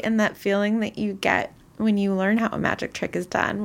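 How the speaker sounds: normal talking voice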